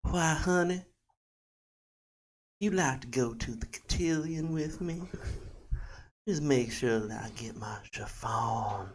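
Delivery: soft, breath-light Southern belle, slowing at the end; Southern debutante. Acted. Debutante inviting honey to the cotillion, Southern female accent. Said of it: "a debutante Southern female accent".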